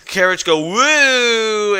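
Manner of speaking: high, slow swooping woo; sound effect voice. Retold or imitated. Narrating the carriage crash, swooping high woo. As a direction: let the woo swoop up as it falls.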